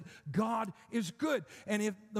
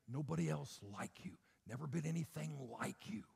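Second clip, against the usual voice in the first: hushed intimate whisper; 6 semitones lower, 10 dB quieter than usual. Acted. Preacher whispering the promise to the congregation. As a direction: breathe it softly to each listener.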